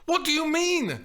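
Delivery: high, loud indignant question; shrieked, affronted demand; outraged. A narrator's reading. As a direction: fire it back in outrage.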